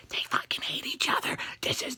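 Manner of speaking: unvoiced conspiratorial whisper; whispered aside. Real, not acted. Vlogger hinting the team will fail, whispering. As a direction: whisper it like a secret.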